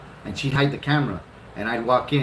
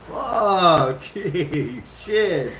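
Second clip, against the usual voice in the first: startled curse; 6 semitones higher, 5 dB louder, much slower than usual. Retold or imitated. Reaction to setting-you-up remark.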